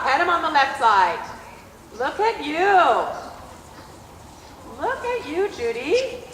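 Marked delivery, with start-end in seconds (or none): none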